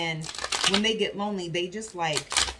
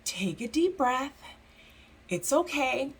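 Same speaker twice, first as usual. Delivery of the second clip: soothing Spirit; 4 semitones higher, slower than usual. Retold or imitated. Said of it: "Spirit is like".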